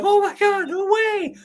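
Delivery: overjoyed, voice shooting up; ecstatic disbelief. Retold or imitated. Fan reliving a wrestler's shock return, ecstatic. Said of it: "it's like".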